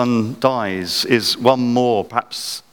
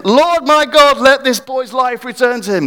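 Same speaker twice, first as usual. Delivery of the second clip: much higher, louder plea; crying out again; 13 semitones higher, 6 dB louder than usual. Retold or imitated. Elijah pleading again for the boy, louder and higher. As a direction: cry out harder the second time. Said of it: "He says again".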